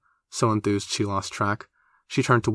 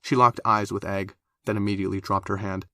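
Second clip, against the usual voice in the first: plain reading voice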